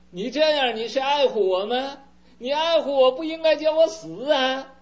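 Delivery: son's protest swelling into a yell; indignant son. Acted. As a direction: scold the old man, building as you go. Eldest son refuses to die.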